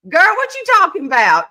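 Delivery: high, loud mock Southern girl talk; Southern-Italian mix voice. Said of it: "I'd take the Italian and".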